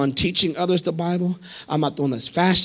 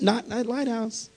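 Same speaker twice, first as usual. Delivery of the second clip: quiet aside; 5 semitones higher, 11 dB quieter than usual. Real, not acted. Contrast with shouted repeat.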